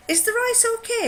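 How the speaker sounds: high, sing-out question; anxious asking. Retold or imitated. Wife serving the meal asks her husband, high retold question.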